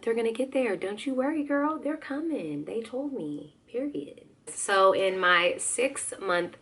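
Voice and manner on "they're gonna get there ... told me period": high sing-song sass, getting softer; sassy reassurance. Pretend. Vlogger telling worried moms not to worry, sassy high reassurance. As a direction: reassure a girlfriend in a playful high lilt.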